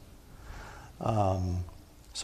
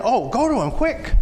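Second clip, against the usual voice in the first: high, louder, eager urging; urging crowd; 11 semitones higher, 8 dB louder than usual. Acted. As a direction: crowd pushing the beggar toward Jesus, eager high urging.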